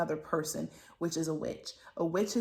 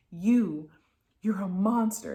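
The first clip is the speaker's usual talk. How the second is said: louder, accusing mock yell; retold yelling; 5 dB louder than usual. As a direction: imitate an angry mom yelling, half-hearted. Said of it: "she's yelling, the, the mom is yelling this".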